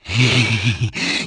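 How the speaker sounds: low mocking laugh; villain laugh. Villain scoffing at the heroes, a low mocking laugh. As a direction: chuckle darkly at their hope.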